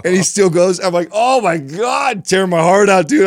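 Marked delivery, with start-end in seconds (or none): 1.1-3.3 retold heartache, pitched way up and emphatic; pained exclamation. Dad reacts to his son's tears, pained exclamation.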